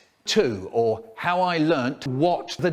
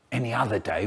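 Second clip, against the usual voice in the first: ordinary delivery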